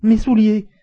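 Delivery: high, loud exclamation of surprise; startled outcry. A narrator's reading. Passepartout seeing his shoes in evidence, startled cry. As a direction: burst out involuntarily, pitch and volume leaping. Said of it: "s'écria Passepartout".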